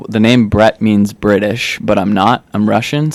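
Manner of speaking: ordinary talk, nothing marked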